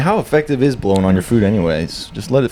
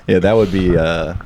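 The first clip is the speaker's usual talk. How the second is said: ordinary delivery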